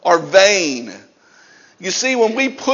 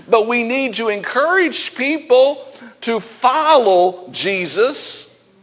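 ordinary delivery

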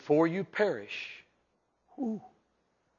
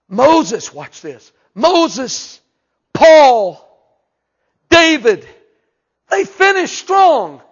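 preaching at full force; 11 semitones higher, 15 dB louder, much slower than usual. Real, not acted. Preaching climax.

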